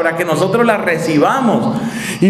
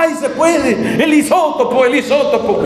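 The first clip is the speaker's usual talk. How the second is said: entusiasmo fingido; 9 semitones higher than usual. Pretend. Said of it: "saltar en la silla".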